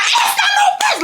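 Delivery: high shouted scolding with a laugh; mock furious mom yelling back; mom going off. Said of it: "she's going off".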